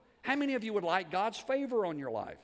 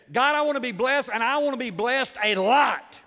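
high, very loud preacher's cry; bold prayer demand; 7 semitones higher, 13 dB louder than usual. Retold or imitated. Jabez boldly asks God for blessing, shouted. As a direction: shout it boldly up to God, punching each word. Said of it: "Here's what he said".

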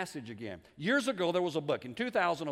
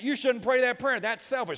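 objecting churchgoer; 7 semitones higher, 9 dB louder than usual. Retold or imitated. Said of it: "we say that".

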